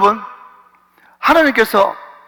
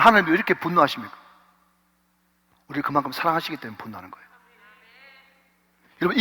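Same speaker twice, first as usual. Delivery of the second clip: ordinary delivery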